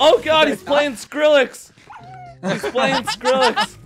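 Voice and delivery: amused disbelief. Real, not acted. Autobot plays loud music.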